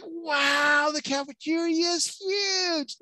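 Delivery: very high, drawn-out gushing; mock amazement. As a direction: gush in a high voice, stretching it out. Acting out photoshopped sales pitch.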